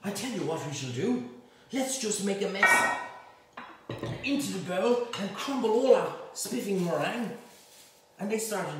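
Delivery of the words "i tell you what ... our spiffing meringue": louder, higher hammed-up toff; plummy scheming, played for laughs; posh schoolboy. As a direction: Eton boy with a rescue plan, hammed-up posh voice. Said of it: "And then they went".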